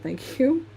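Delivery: "thank you" rising sharply into a question. Retold or imitated. Streamer answering British friends' remark, questioning rise on "thank you". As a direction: lift "you" high, unsure if it's a compliment. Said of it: "Question mark?".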